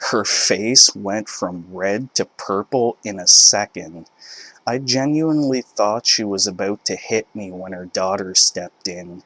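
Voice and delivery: usual speaking voice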